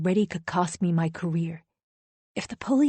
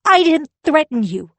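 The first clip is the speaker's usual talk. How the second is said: high, loud angry shout; shouting back; 8 semitones higher, 9 dB louder than usual. Acted. Wife shouts back at her husband, loud and angry. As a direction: throw it straight back at him. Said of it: "I shout back".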